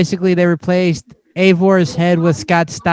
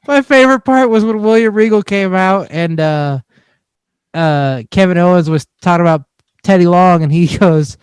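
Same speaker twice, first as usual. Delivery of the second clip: normal talking voice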